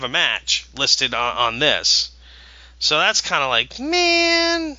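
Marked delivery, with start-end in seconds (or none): 3.8-4.8 exasperated groan. Real, not acted. Drawn-out reaction to missing match.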